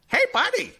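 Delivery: very high, squawky greeting; parrot voice. Acted. Parrot greeting the guy walking by, parrot voice. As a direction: call out as a talking parrot. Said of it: "and the parrot goes".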